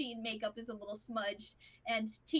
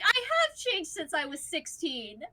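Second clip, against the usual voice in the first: higher, louder mock indignation; indignant retort; 6 semitones higher, 7 dB louder than usual. Husband joking back at scolding wife, mock indignant. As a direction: protest to set up the joke.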